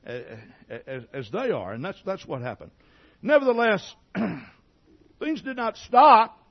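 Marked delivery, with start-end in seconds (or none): 3.2-6.4 sermon peak, much louder and higher; preaching at full force. Acted. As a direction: shout it out to the congregation. Preaching climax.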